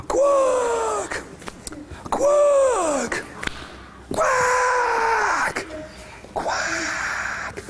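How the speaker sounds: high, drawn-out quacks fading at the end; high-pitched quacks, trailing into breath; sleeping duckling. Acted. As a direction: let long quacks drift off to sleep. Duckling quacks while falling asleep.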